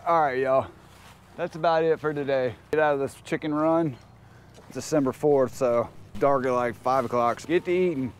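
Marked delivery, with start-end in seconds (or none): none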